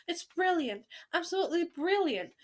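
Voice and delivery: high, excited praise; thrilled storybook grandmother; delighted shout. A narrator's reading. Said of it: "she shouted".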